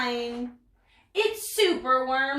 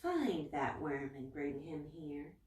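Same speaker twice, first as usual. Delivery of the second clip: low, much softer mutter; muttering lizard; 7 semitones lower, 12 dB quieter than usual. Acted. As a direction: mutter the order close in the servant's ear. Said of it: "He mutters in his servant's ear".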